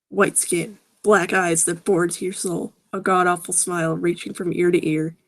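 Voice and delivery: ordinary delivery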